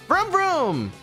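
high playful engine sound; car noise. Retold or imitated. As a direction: imitate a revving car. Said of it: "you know what they say".

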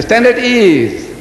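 mock drill sergeant's call; parade command. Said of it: "But when you say".

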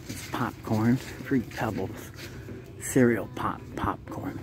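whispered product names; soft hush while browsing. Real, not acted. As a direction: breathe the words, no voice. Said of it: "whispers product names".

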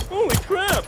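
sudden high shocked yelp; surprised yelp. Retold or imitated. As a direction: blurt it out in shock. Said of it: "He's like".

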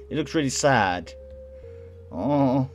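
high, loud sympathetic cooing; exaggerated sympathetic aww; pitying aww. Real, not acted. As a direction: let pity lift the voice. Pitying a sad game character.

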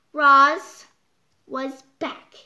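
ordinary delivery

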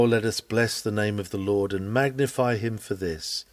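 ordinary talk, nothing marked

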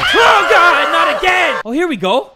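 high, loud panicked yell; scared horror game streamer. Real, not acted. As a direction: scream at the jump scare.

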